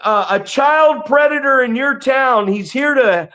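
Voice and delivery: high, loud mock announcement to the whole street; hammed-up shouted call-out, slowed at the start; imitating a scream-out. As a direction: call it out like a public alarm. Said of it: "I love watching him scream out".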